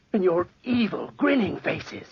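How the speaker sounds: frantic accusation. Acted. Charles to the inspectors, frantic and high. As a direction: accuse them in terror.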